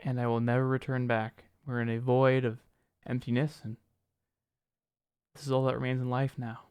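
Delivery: quiet, bleak end-of-the-world monologue; hushed, hammed-up existential despair; mock desolate. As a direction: murmur it softly as if left in an empty void. Mock-bleak monologue after trailer ends.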